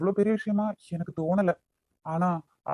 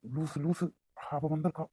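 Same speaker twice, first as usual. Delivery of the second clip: hushed warning; 3 semitones lower, 10 dB quieter than usual. Acted. Hushed warning, someone arrived.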